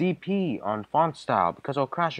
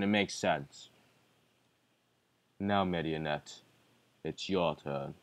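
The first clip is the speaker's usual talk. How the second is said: deep villain voice; 7 semitones lower than usual. Acted. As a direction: speak as a cold deep villain. Villain character lines.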